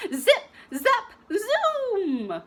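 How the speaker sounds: high, loud gleeful chant with a laugh; gleeful play. Acted. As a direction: zoom around giggling. Viola skating wildly.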